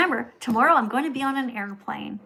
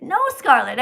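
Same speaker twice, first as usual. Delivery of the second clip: normal talking voice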